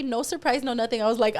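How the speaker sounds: ordinary delivery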